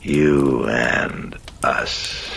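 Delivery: very drawn-out demonic croak. Acted. Demon repeats its invitation to the priest, a drawn-out croak. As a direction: stretch each word out like a croaking demon. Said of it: "croaked the demon".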